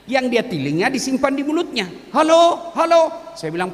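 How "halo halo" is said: much higher, louder, hammed-up old man yelling halo; shouting into the phone. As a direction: yell into a phone held upside down.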